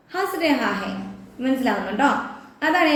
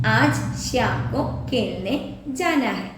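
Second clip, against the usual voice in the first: slow model sentence; 3 semitones lower, much slower than usual. Shown as a demonstration. Teacher models Hindi sentence.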